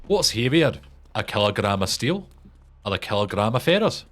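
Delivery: mock-Scottish question; Limmy. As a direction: quiz question in a Scottish accent. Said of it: "quoting a Limmy show".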